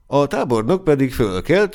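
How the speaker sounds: usual speaking voice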